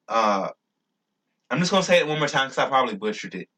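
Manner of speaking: ordinary talk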